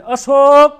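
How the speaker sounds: loud, eager call of a new bride; a new wife sweetly calling her husband's name; newlywed's loving call. Acted. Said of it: "લગ્ન થાય નવા નવા".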